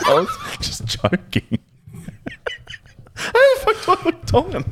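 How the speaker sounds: high, laughing incredulous words. Real, not acted. Telling friends his ex's mum thought he was Tongan, laughing. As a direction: crack up at the absurdity.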